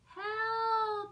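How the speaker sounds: high, loud call for help; cry for help. Acted. Pip drowning calls out for help, high and loud. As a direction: cry out from the water. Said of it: "she cried".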